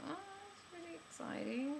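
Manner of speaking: high, soft swooning murmur; secretly thrilled. Retold or imitated. Stranger touches her arm, soft high thrill. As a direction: melt quietly at the touch.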